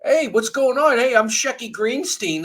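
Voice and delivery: hammed-up cheesy comic opener; mock cheesy comedian. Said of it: "I'm kinda horrified that you said".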